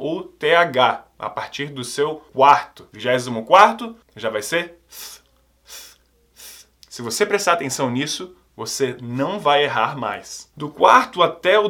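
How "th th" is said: th sussurrado, língua entre os dentes; th sound isolated. Shown as a demonstration. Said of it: "colocar a língua entre os dentes". (som de th soprado e isolado)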